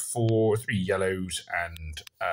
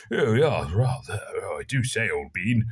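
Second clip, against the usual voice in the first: mock upper-class British toff; hammed-up posh English, swooping on the first words; posh British accent; 3 dB louder than usual. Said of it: "there's your posh accent".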